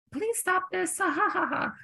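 very high plea with fake laugh; embarrassed pleading. Begging friend to stop as people stare, high and embarrassed. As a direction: squeal it through a forced awkward laugh.

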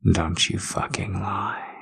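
soft unvoiced threat, hissed close; menacing whisper. Dominant man warning his partner not to lie, menacing whisper. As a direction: whisper the warning into her ear.

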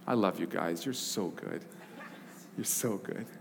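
low, soft teasing aside. Real, not acted. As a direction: murmur it fondly.

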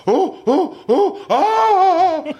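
high, loud laughing ooh-ah yelps, stretched slow; roller coaster squeals. Retold or imitated. Guest imitating his VR roller coaster ride, high, laughing, slow squeals. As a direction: squeal like you're dropping down the coaster. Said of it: "it's like".

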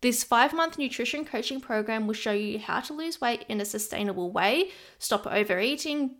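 bright promo voice for her coaching program; ad read. Acted. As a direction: sell the program with energy.

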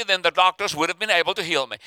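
ordinary delivery

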